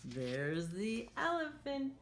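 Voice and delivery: sing-song storybook read for little ones; lively picture-book voice; reading to children. Acted. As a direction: read it like a teacher at story time. Teacher reads picture book aloud.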